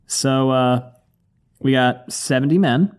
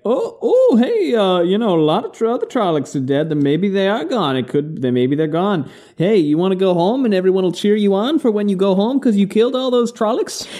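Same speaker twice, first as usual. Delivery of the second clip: high, wheedling mock character voice; Luke; 8 semitones higher than usual. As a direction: coax in a hammed-up high voice. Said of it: "And Luke is like".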